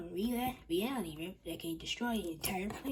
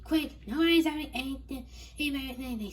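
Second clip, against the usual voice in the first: urgent shout; 7 semitones higher, 7 dB louder, faster than usual. Acted. Miss Brain urgent shout.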